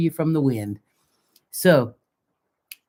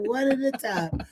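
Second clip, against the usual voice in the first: laughing; 7 semitones higher, 5 dB quieter than usual. Acted. Repeated again, laughing.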